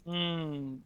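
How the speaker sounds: usual speaking voice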